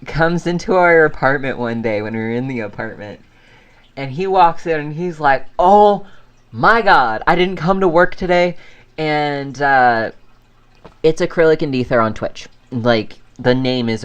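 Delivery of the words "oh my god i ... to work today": higher, louder mock exclamation; exasperated retelling. Friend walking in to explain a missed shift, exasperated. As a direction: act out his worked-up entrance.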